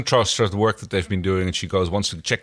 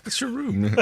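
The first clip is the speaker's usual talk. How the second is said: laughing mimic; 13 semitones higher, slower than usual. Mimicking character line, laughing.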